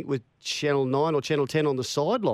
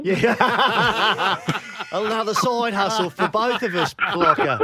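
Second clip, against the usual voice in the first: laughing through the words, pitched up; 7 semitones higher, 4 dB louder, slower than usual. Real, not acted. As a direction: chuckle it out, amused by the offer. Laughing at the job offer.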